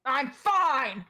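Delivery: insisting fine. Acted. Mel hurt, denying it.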